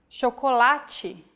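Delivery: chocolate said the Portuguese way, CH as SH; Portuguese pronunciation. Shown as a demonstration. Teacher showing the CH digraph, chocolate the Portuguese way. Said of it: "we have the sound".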